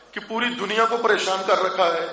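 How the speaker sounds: usual speaking voice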